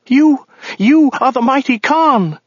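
high-pitched terrified woman's voice; frightened cry. A narrator's reading. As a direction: cry out in awe, very high and drawn out. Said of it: "cried the woman".

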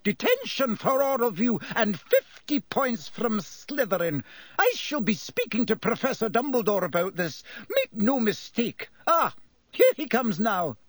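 high-pitched, indignant scolding; furious shouting. A narrator's reading. McGonagall punishing the Slytherins, shouting in fury. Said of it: "she was shouting".

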